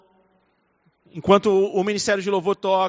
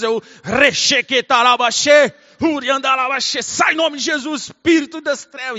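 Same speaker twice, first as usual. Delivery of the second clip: loud, higher, forceful tongues rebuking; angry warfare prayer; 6 semitones higher, 6 dB louder than usual. Shown as a demonstration. Pastor rebuking the spirit of darkness, fierce and loud. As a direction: fight the devil with a firm commanding voice. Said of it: "começo a ficar bravo com o diabo".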